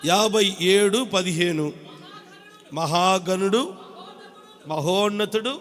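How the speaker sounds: slow, measured Bible reading; a preacher reading scripture to the church; solemn scripture reading. Acted. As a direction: read the verse slowly, weighing each word.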